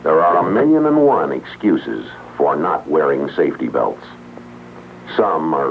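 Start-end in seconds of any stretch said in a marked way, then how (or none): none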